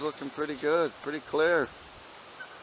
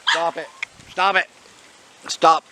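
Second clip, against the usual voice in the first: sharp raised scold at a pet; scolding the dog; 4 semitones higher, 7 dB louder than usual. Real, not acted. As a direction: tell the dog off firmly. Scolding pet tearing screens.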